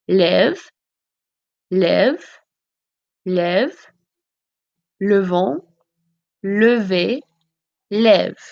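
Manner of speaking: each form of lever spoken alone with a pause, lève with an open è; conjugations said slowly one by one. Shown as a demonstration. Teacher to class, saying each form slowly and clearly. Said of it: "Listen to me say the conjugations".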